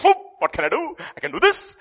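high, loud mock bragging; boasting cricket. Cricket bragging to the inchworm, a loud high boast. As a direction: show off like a cocky cricket. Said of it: "one insect showing off another".

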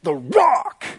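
high, strained mock-macho boast; hyped-up swagger. Peter boasts the church will prevail, hammed-up swagger. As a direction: ham it up like a triumphant wrestler.

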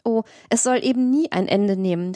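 ordinary delivery, nothing marked

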